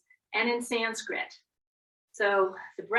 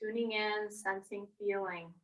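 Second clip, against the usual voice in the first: guided meditation; 2 semitones lower, 5 dB quieter than usual. Acted. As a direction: ease them down gently. Slow soft guided cues.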